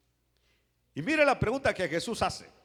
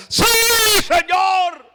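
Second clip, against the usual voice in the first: long, high, loud yes; eager shouted answer; 13 semitones higher, 15 dB louder, much slower than usual. Acted. Paralytic answering Jesus' offer of healing, shouted eagerly. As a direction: shout it with all your might. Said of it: "contestó de esa manera".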